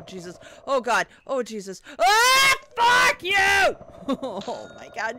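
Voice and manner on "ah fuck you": high, loud screaming curse; furious scream. Real, not acted. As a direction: scream it at the enemy. Screams at game enemy.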